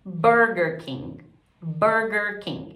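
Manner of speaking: slow "Burger King" with an American R; teacher's slow demo of English "Burger King". Shown as a demonstration. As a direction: model "Burger" with curled English R, not guttural. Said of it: "trabalhar essa pronúncia do R".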